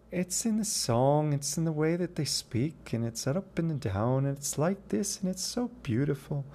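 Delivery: a hint of Irish lilt, sing-song up and down; light Irish accent. Shown as a demonstration. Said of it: "That's not my full Irish accent".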